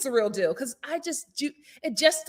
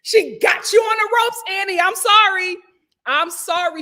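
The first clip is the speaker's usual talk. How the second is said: loud high teasing call-out; teasing shout; 8 semitones higher, 7 dB louder than usual. Real, not acted. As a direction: shout it at the TV, delighted.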